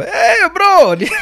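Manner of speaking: high, loud cry of disbelief; shocked disbelief. Retold or imitated. Retells call to Luigi, upset.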